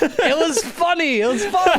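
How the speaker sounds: words squeezed out through real laughter; laughing. Real, not acted. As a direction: giggle through it, still amused. Laughing at own prank story.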